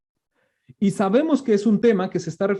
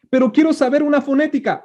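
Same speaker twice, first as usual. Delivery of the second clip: higher and fast, mildly exasperated Moses; Moses insisting; 6 semitones higher, faster than usual. Retold or imitated. Moses demanding how the name sounds, higher and hurried. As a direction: rush the demand, a touch impatient. Moses questions God in retelling.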